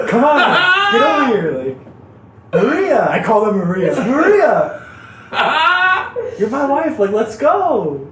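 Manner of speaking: high, loud, laughing pleas; excited calling out. Retold or imitated. Retelling calling the nurse Maria his wife, high laughing shouts. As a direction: beg her over, half laughing.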